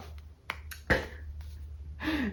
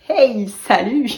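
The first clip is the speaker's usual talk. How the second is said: playful hello; 3 semitones higher, 7 dB louder, slower than usual. Pretend. Greeting the basket playfully.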